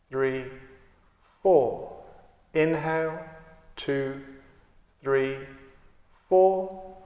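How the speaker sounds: calm, unhurried meditation guide with long pauses; guided meditation. Acted. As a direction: count and guide the breath steadily, leaving space between phrases. Leads a guided breathing meditation.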